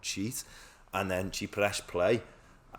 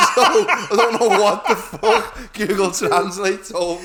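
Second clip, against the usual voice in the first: slow, laughing, higher and louder; cracking up at own failure; wheezing laugh through the story; 11 semitones higher, 13 dB louder, slower than usual. Real, not acted. Laughing at failing despite cheating.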